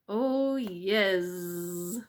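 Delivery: slow, loud sing-song bees; drawn-out happy bee chorus; buzzing bees. Acted. Bees teasing the mouse, slow and loud.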